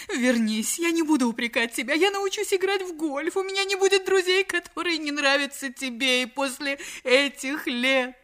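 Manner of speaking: high, slow, tearful-sounding begging; voiced wronged wife imploring; pleading wife. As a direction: beg him to come back. Wife begs husband to return.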